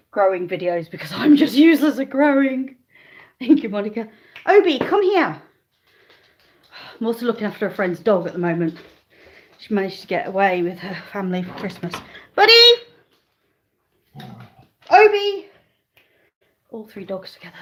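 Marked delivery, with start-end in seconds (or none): 4.5-5.4 high, loud call to the dog; pitched-up dog call. Real, not acted. Calling the dog over. 12.3-15.5 high, loud shout of the dog's name; sharp high call for the dog. Real, not acted. Calling the dog.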